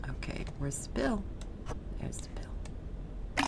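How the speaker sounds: very slow breathy whisper; slow hushed search. Real, not acted. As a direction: whisper it to yourself, drawn out. Searching for item in game.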